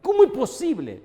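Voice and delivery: a furious ¿Cómo es posible?; angry outburst. Retold or imitated. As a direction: burst out in sudden anger. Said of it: "se me entró un enojo".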